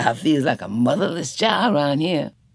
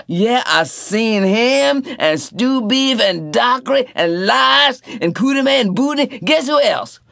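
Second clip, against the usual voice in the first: high, loud, eager storyteller man; excited Tea Cake; 11 semitones higher, 6 dB louder than usual. A narrator's reading. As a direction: Tea Cake bursting with gossip. Tea Cake tells the news.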